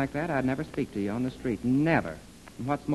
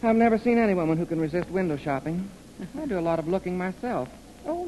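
ordinary talk, nothing marked

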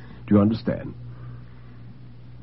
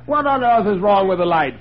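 high-pitched, irritated demand of the butler; sharply raised voice; 9 semitones higher, 4 dB louder than usual. A narrator's reading. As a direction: demand an explanation at once. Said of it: "said Sir William sharply".

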